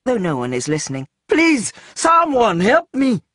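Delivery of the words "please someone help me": high, loud, slow plea; desperate call for help. A narrator's reading. Caged man calling out to nobody listening. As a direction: cry out to anyone who might hear.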